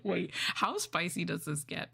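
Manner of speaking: usual speaking voice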